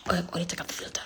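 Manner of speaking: breathy whispered aside. Real, not acted. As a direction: hush it out like talking to yourself.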